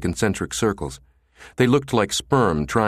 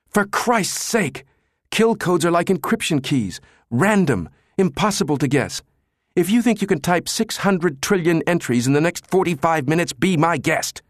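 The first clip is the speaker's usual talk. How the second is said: high, sarcastic rant, the first words dragged out; exasperated Jabba; 9 semitones higher than usual. A narrator's reading. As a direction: scoff at a foolish idea.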